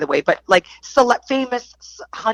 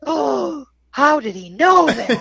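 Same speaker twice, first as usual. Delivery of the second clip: shocked surprise; 7 semitones higher, slower than usual. Retold or imitated. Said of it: "And I was like".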